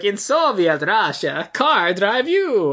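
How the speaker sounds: slow, drawn-out fake Russian accent; mock Russian accent. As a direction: deliver a hammy Russian-accent punchline. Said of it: "it sounds like".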